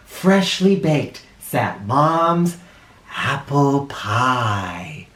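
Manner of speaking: very slow, savoured words; dramatic reveal. A narrator's reading. Narrator unveiling Mom's apple pie, slow and savoured. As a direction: draw out the reveal.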